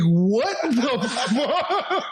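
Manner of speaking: slow, laughing through it; drawn-out laughing what; laughing disbelief. Retold or imitated. Retelling his reaction to the news, slow and laughing. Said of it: "I was like".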